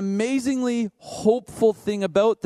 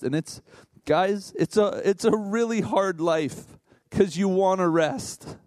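usual speaking voice

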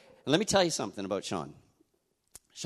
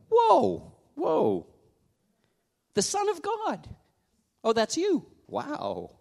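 much higher, louder whoa of wonder; amazed; 4 dB louder, slower than usual. Pretend. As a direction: gasp in awe at the news. Amazed at status with God.

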